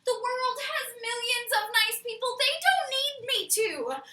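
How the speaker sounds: loud, high-pitched pleading; exasperated protest. Acted. Begging a partner to put the box away, exasperated protest. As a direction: wail the argument in comic desperation. Refusing to become nice.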